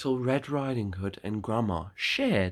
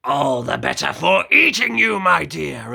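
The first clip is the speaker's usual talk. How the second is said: much louder, triumphant wolf snarl; loud menacing wolf; 5 semitones higher, 11 dB louder than usual. Acted. Wolf reveals himself to the girl, loud menacing growl. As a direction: pounce on "eating" with full menace.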